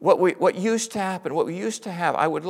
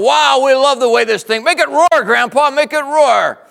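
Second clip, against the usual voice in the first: high, loud excited shout; thrilled kids begging; 9 semitones higher, 11 dB louder than usual. Retold or imitated. Excited grandkids in Mustang.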